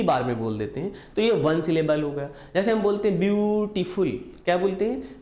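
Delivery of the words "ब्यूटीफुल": ब्यूटीफुल split as view, then टीफुल; pause inside ब्यूटीफुल. Shown as a demonstration. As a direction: stretch view in ब्यूटीफुल, break briefly, then finish टीफुल. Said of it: "इसको हमें बीच में pause लेना पड़ता है". (ब्यूटीफुल के बीच में रुककर: ब्यू… टीफुल)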